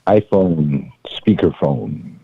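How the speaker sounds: muffled phone voice; sounding muffled over speakerphone. Real, not acted. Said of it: "you sound very muffled".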